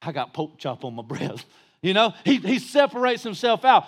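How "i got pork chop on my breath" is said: sheepish Peter aside. Retold or imitated. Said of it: "He's like".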